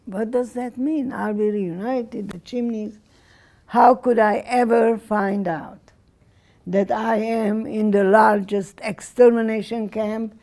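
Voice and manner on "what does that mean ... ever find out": ordinary talk, nothing marked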